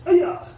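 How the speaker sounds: piercing high yell on the technique; kiai shout. Shown as a demonstration. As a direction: snap out the yell with the move. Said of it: "Number two is like this".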